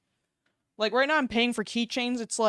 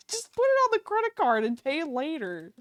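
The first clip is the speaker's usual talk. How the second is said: higher, sing-song, laughing through it; mock carefree; 7 semitones higher than usual. Shown as a demonstration. Streamer joking to viewers about debt, mock carefree. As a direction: sell the bad idea with a giggle. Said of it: "right, guys?".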